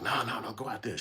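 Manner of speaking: high, panicked pleading; mock panicked woman; freaking out. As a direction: beg them not to go out. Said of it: "She was freaking out".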